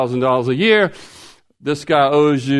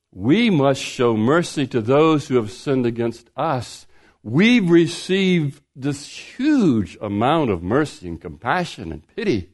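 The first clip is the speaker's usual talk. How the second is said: normal talking voice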